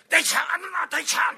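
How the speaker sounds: loud, high insistent calling; forceful call. Retold or imitated. Master pressing close at fifty centimetres, forceful call. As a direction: drive his name home with fire. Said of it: "って来るんで".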